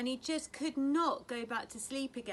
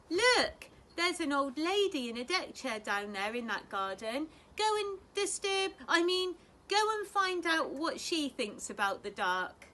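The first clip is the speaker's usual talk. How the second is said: exasperated mother; 5 semitones higher, 5 dB louder than usual. A narrator's reading. Mother sends Plop off.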